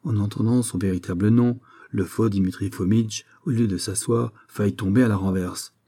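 usual speaking voice

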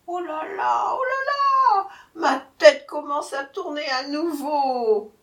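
very high, loud lament; dizzy, wailing jackal. A narrator's reading. Jackal feigning confusion to the tiger, wailing. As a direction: wail as if your head is spinning.

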